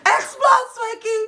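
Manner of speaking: very high, squealed urging; cheering him on. Real, not acted. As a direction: egg him on in a high yell. Host cheering on the explosion.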